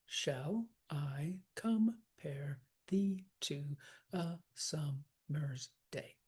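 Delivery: very slow, each syllable set apart; counting syllables. Shown as a demonstration. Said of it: "count the number of syllables".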